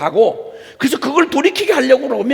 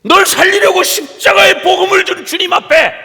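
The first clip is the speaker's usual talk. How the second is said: loud, high pulpit shout; impassioned, shouted exhortation; full-force preaching; 9 semitones higher, 7 dB louder than usual. Real, not acted. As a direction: thunder a challenge at the congregation.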